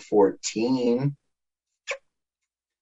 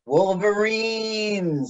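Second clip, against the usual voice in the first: dramatic movie call; 9 semitones higher, 3 dB quieter, much slower than usual. Retold or imitated. Quoting the movie line.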